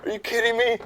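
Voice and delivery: high, loud retold outburst; mock freak-out. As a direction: blurt it out in disbelief, pitched high. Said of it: "I'm, like, just freaking out".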